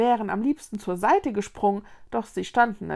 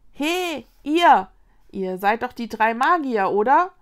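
loud, raised hail to strangers; calling out; 3 semitones higher, 8 dB louder than usual. A narrator's reading. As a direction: call over to get their attention. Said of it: "rief eine Tanne". (laut herüberrufend)

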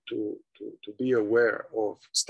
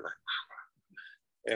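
voiceless, hushed whisper sound; very soft wordless whispering; imitated sinister whispering; 10 dB quieter than usual. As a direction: mimic an evil magician whispering into an ear. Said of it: "was whispering into his ear".

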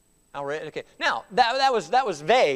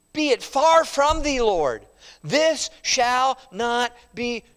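firm, higher rebuke, slow; strong rebuke; 5 semitones higher, 4 dB louder, much slower than usual. Acted. Peter rebuking Jesus, strongly and firmly. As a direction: Peter pulls Jesus aside, scolding him firmly. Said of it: "speaking strongly to Jesus".

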